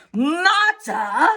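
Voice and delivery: a father's outraged yell; mock yell. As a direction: yell the word back at her. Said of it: "he yelled".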